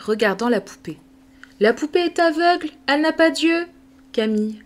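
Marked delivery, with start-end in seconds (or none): 1.6-3.6 put-on surprised girl. Madeleine exclaims.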